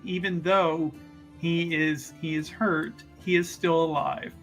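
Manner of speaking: usual speaking voice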